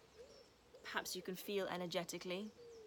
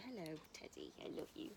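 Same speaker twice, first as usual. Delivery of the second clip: soft aside to dog; 2 semitones lower, 7 dB quieter, faster than usual. Real, not acted.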